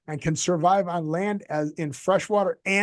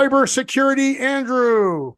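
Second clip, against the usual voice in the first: hype intro; 8 semitones higher, 6 dB louder than usual. Acted.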